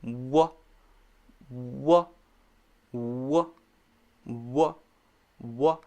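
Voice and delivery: "W" with lips catching then releasing the voiced sound; correct W. Shown as a demonstration. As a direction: round the lips and push "w" out. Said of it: "So this is correct".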